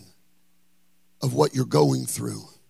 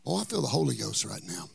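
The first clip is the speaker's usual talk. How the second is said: Oh, I feel the Holy Ghost right now said much softer and lower; hushed exclamation; 4 semitones lower, 9 dB quieter than usual. Acted. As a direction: say it quietly, almost to yourself. Quiet after the peak.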